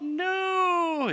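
high squeaky denial; voice goes up. Retold or imitated. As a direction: squeak the no like a caught liar. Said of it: "Their voice goes up".

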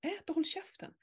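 quick mock snap, soft; what she wanted to bark at him. Mother voicing what she wanted to say to her son, mock snap. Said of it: "jag vill bara så här".

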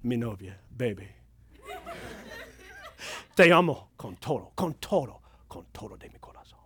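very low, hushed Spanish sweet talk; real romantic. Acted. Preacher wooing pretend Spanish girlfriend, real romantic. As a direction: fade toward a near-whisper, hammed-up romance. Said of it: "I mean, like, real romantic".

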